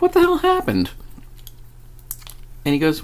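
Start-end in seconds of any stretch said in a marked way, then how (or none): 0.0-0.9 much higher, incredulous question; a mock-bewildered squeak of disbelief; imitating total confusion; baffled. Baffled at sudden recovery.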